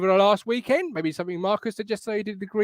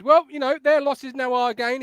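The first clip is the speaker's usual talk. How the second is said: ordinary talk, nothing marked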